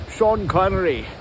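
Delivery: slurred-s Scottish impression; put-on Scottish accent; Sean Connery. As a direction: do a Scottish Bond. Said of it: "he did a Scottish accent".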